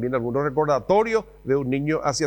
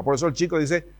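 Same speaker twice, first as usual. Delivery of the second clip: usual speaking voice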